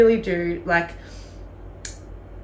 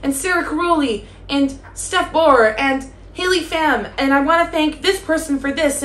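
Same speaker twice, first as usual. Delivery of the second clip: high-pitched, hammed-up award winner's gratitude; mock Oscar speech; 5 semitones higher than usual. Holding a pretend trophy at the Oscars, hammed-up thank-you speech. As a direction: play an overwhelmed winner thanking the academy. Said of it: "this is my thank you speech at the fucking Oscars".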